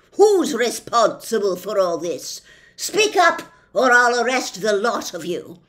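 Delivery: loud, slowish officious policeman; pompous policeman. Acted. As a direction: lay down the law with bluster. Policeman demands answers.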